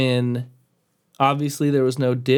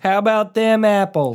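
put-on Boston accent, pitched up; New England accent; 7 semitones higher, 3 dB louder than usual. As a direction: try a thick Boston accent. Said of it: "That's my go at a New England accent".

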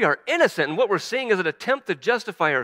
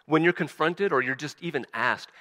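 ordinary talk, nothing marked

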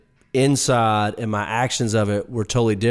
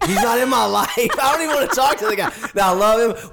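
laughing, squeaky-high rant; high-pitched protest; 13 semitones higher, faster than usual. Real, not acted. Venting to co-hosts about a man he avoids, high-pitched protest. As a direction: voice jumps way up, protesting through laughter.

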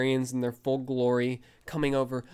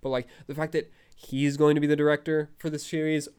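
ordinary delivery

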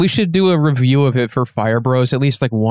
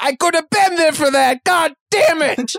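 put-on New York accent; 13 semitones higher than usual. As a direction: moan it like a New Yorker who missed out. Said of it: "I give an L A person a New York accent".

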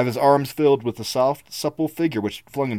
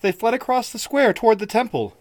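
higher anxious answer; anxious girl; 10 semitones higher than usual. Acted. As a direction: tell him quickly where they went. Ivga answers.

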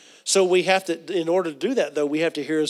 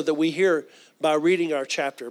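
ordinary delivery

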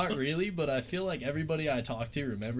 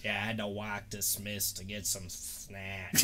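imitating lisping coworker; 4 semitones lower, 4 dB quieter, slower than usual. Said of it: "'Cause he had a lisp".

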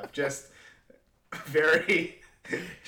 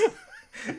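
airy squeaky giggle; breathy high laugh; 4 dB quieter than usual. Real, not acted. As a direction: laugh out through the nose. Laughing at the memory.

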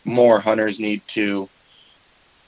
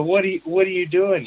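mock incredulous; 8 semitones higher than usual. Retold confrontation with the kid.

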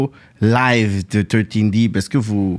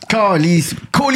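playfully exaggerated Québécois cursing, pitched up; mock angry swearing; 8 semitones higher, slower than usual. Friend teases him about swearing when angry, mock-angry. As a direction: curse in pretend anger, drawing out the swear. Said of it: "quand je suis en colère, je, je, je, je sacre".

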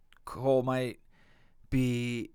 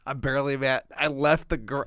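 normal talking voice

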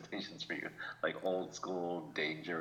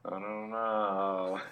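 doubtful; much slower than usual. Acted. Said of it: "I'd be like".

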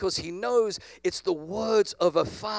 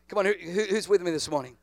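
ordinary talk, nothing marked

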